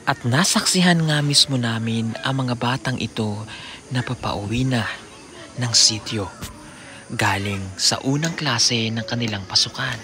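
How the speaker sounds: low, steady voiceover; documentary narration. A narrator's reading. Documentary voiceover over music.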